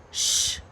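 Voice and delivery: unvoiced shush; drawn "shh". A narrator's reading. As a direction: hush someone urgently. Rat hushes the girl again.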